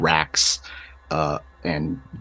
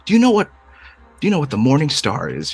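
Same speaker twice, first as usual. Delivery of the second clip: lightly voiced smooth-talking manipulator, raised pitch; Kanawa; 5 semitones higher than usual. Kanawa explaining destiny to a child, lightly acted coaxing. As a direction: coax a child, with a hint of character voice. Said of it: "then you hear Kanawa's voice".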